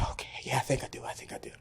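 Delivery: whispered, unsure reassurance; a scared student whispering back; nervous whisper. Retold or imitated. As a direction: whisper it, trying to convince yourself. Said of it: "He said".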